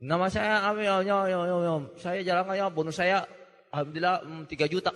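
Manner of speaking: words smeared as if gargling; garbled testimonial, only a few words clear; imitated gargled mumbling. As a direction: mumble through it like a mouth full of water. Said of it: "ya kumur-kumur ya, tapi kedengaran juga ya".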